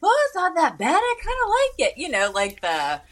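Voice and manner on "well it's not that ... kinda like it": higher-pitched retold dad voice; imitating dad. Dad shrugging off a bad beer, imitated high-pitched. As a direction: mimic dad's easygoing verdict up high. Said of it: "Dad was like".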